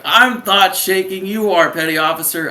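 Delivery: hammed-up recruit talking back; exaggerated defiant comeback. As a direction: protest indignantly, playing it for laughs. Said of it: "I didn't say it like that".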